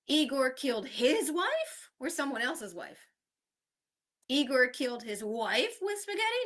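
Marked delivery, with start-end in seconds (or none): 0.1-1.9 HIS punched, rising higher on "his wife"; stress on his. Shown as a demonstration. Teacher showing how stress changes meaning, his stressed. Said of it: "changing the stress in each". 4.3-6.4 WIFE punched, rising high through the end; stress on wife. Shown as a demonstration. Teacher showing how stress changes meaning, wife stressed. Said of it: "changing the stress in each".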